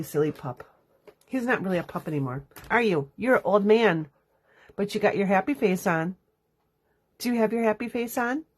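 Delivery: usual speaking voice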